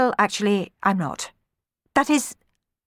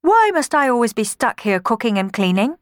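higher-pitched indignant protest; objecting; 6 semitones higher, 4 dB louder than usual. A narrator's reading. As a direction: object to unfair treatment. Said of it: "objected Cenerentola".

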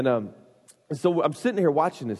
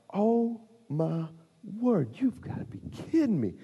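stunned disbelief; 6 semitones higher, 4 dB quieter than usual. Retold or imitated. Onlooker watching kid spin on the floor, stunned disbelief. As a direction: stretch it out, high and awestruck.

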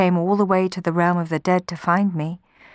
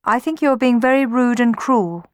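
hurt, higher-pitched complaint; grumbling; 7 semitones higher, 5 dB louder than usual. A narrator's reading. Eurydice to a silent Orpheus, hurt grumbling. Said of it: "she grumbled at last".

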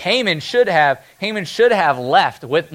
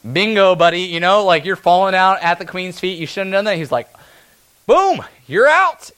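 louder mock gotcha king; gleeful king; 3 semitones higher, 4 dB louder than usual. King catching Haman at Esther's feet, gleeful. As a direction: pounce on the moment with glee. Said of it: "comes back and is like".

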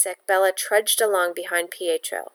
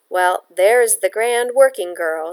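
slow, louder scornful jeer; mock her with a snort; snorting sneer; 5 dB louder, slower than usual. Landlady scorning the girl, slow sneer. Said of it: "Signora Luciano snorted".

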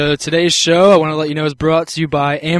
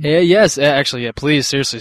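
ordinary talk

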